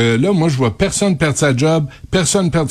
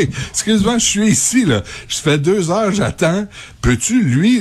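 raised, exasperated customer; annoyed customer; 6 semitones higher than usual. Retold or imitated. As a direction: complain like a fed-up customer. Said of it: "quand ça arrive".

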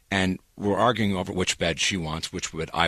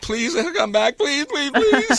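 mock begging; 3 dB louder, slower than usual. Retells begging the assemblers to return.

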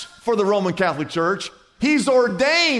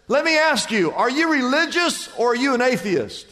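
high-pitched acted question; lightly hammed-up passerby; urgent questioning; 8 semitones higher, 3 dB louder than usual. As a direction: press him for an answer, voice raised in pitch. Passerby questions jumper in joke.

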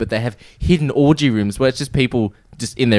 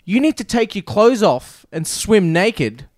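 higher, slow lecturing voice; bossy roleplayer; 8 semitones higher, slower than usual. Retold or imitated. Roleplay gamer scolding a newbie, slow bossy voice. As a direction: lay down the rules slowly. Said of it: "the guy goes".

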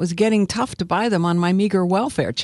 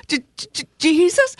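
high, halting whimper; tearful stutter; 12 semitones higher than usual. Acted. Sobbing convert echoes the prayer, tearful high stutter. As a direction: stammer it out in a high voice. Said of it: "I echoed the first one back to her".

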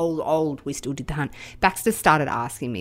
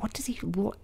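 whisper; 3 semitones higher, 9 dB quieter than usual. Retold or imitated. Wondering aloud to herself.

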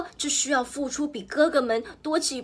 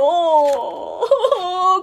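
triumphant laugh; 6 semitones higher, 6 dB louder than usual. A narrator's reading. Wolf knocks house down.

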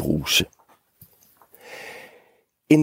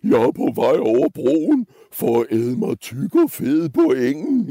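raised, wobbling put-on goat voice; would-be authoritative goat; 5 semitones higher than usual. Middle goat explaining himself to the troll, high would-be authority. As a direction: try to sound important as a goat. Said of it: "forsøgte at lyde myndig".

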